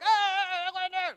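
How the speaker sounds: high, drawn-out mock holler; hammed-up wordless crowd yell; comic high-pitched wail; mock shouting. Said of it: "they shouted to the people".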